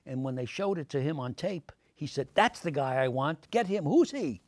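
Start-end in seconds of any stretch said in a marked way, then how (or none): 2.3-4.4 loud, rising burst of enthusiasm; retold boss's eager outburst; excited demand. Network boss's thrilled reaction, retold.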